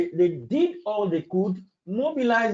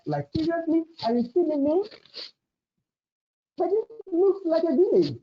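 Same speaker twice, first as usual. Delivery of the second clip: higher, disbelieving voice of the wife; mock-shocked wife, pitched up; incredulous wife; 8 semitones higher than usual. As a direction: act stunned that London looks small. Wife's stunned reaction in London.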